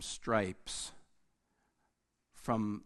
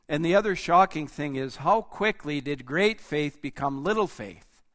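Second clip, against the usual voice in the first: louder, higher rousing sermon; preaching at full force; 4 semitones higher, 7 dB louder than usual. Acted. Preacher stirring his congregation about faith, full force preaching. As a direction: build the congregation up with rising fervor.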